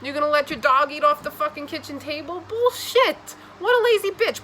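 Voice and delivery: high, loud outraged rant; exasperated tough-love yell; ranting scold. Real, not acted. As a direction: berate them, voice cracking up high. Ranting at the viewer.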